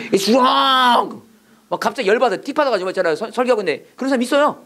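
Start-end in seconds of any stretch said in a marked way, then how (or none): 0.1-1.2 high, drawn-out heckle; mock angry outburst. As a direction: imitate a congregant calling out in protest. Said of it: "소리 지르면서".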